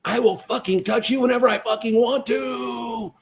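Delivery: retold angry shout. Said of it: "I got about this close to her and I go".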